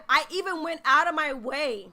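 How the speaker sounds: usual speaking voice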